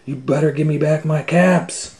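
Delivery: loud, high angry shout. A narrator's reading. Peddler shouts at the monkeys for his caps, angry shout. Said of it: "he shouted".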